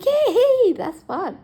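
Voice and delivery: sudden high, loud sarcastic hey; mock cheer. To staff blocking doors, sarcastic high cheer.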